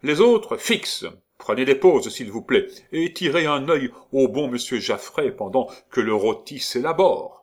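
loud barked orders; commanding shout. Acted. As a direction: command the room. Gondrequin commanding the painters.

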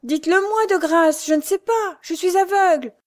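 hands clasped, begging for direction; desperate plea. A narrator's reading. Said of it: "demanda Louise en joignant les mains". (aveugle qui implore, lente, aiguë et forte)

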